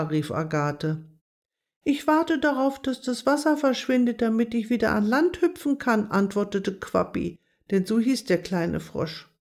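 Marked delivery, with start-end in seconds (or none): 1.8-6.1 lightly raised, small frog voice; a hint of a tiny frog, hopeful; little frog voice. A narrator's reading. Little frog answers the hazel mouse, lightly higher voice.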